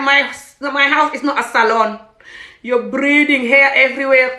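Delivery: imitating the husband yelling at her; retold angry rant. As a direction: voice his fury, loud and high.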